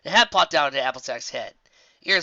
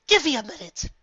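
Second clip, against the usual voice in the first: high, refined lady voice; a genteel correction; prim Rarity; 12 semitones higher than usual. Acted. Rarity corrects Applejack's rough handling, prim and high.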